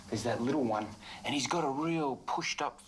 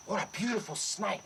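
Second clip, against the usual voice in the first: ordinary talk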